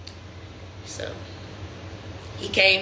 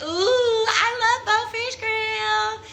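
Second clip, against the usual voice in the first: way higher, slow gushing ooh; mock delight; 10 semitones higher, slower than usual. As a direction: coo over the restaurant like a naive girl. Mocking her own naive delight.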